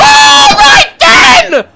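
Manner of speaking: very high, loud shocked yell; real fright; startled shout. Real, not acted. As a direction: jump out of your skin. Jump scare by screaming painting.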